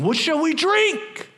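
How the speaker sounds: high, slow whining complaint; whiny grumbling. Retold or imitated. People grumbling against Moses, whiny.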